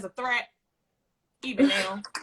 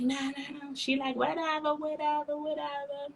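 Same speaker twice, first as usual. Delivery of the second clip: dismissive mumble; 4 semitones higher, 8 dB quieter than usual. Retold or imitated. Mocking dismissive backing off.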